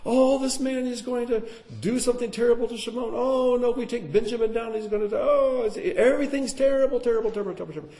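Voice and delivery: high, fretful voice of panicking brothers; worried brothers. Acted. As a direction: wring your hands and fret out loud.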